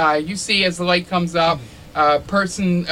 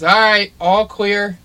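calling out; 5 semitones higher, 6 dB louder, slower than usual. Acted. Jenny calls out all clear.

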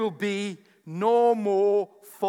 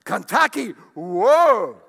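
excited food cravings; 8 dB louder, much slower than usual. Retold or imitated. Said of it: "you tell 'em, you know, you go".

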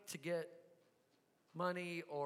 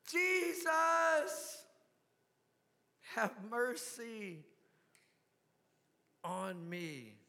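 plea; 2 semitones higher, much slower than usual. Retold or imitated. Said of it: "I'm like".

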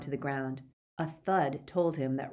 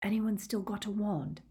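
higher, hushed question; secretive, pitched-up murmur; whispered; 5 semitones higher, 3 dB quieter than usual. A narrator's reading. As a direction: ask it furtively among prisoners. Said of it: "Harry whispered to the other prisoners".

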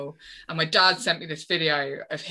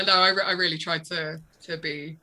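ordinary talk, nothing marked

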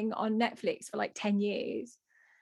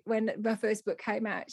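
ordinary talk

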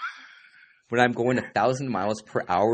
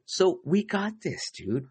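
high slow hype; 12 semitones higher, slower than usual. Acted.

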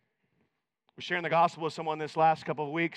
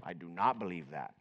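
stubborn churchgoer; 11 semitones lower, 11 dB quieter than usual. Retold or imitated. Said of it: "and he goes".

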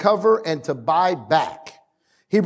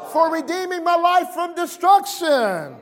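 congregational praise shout; 10 semitones higher than usual. Shown as a demonstration. Preacher urging the congregation to give thanks, high shout. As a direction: shout thanks for all to join. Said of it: "Somebody shout".

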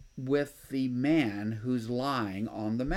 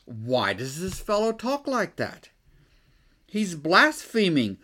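indignant scribes; 3 semitones higher, 5 dB louder than usual. Retold or imitated. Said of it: "they're thinking to themselves".

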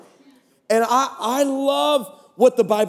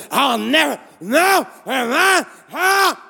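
mock demon outburst; 5 semitones higher, slower than usual. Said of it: "she's like".

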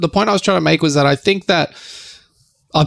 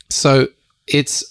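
ordinary talk, nothing marked

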